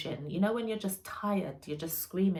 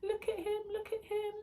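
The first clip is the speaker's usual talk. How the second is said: mum gushing; 13 semitones higher than usual. Retold or imitated. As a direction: coo over the baby like a proud grandma. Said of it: "I could hear my mum saying".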